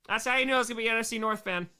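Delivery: ordinary talk, nothing marked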